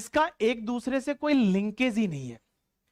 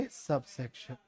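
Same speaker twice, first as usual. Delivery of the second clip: soft answer cue; 9 semitones lower, 10 dB quieter, slower than usual. Shown as a demonstration. Teacher answers own quiz question.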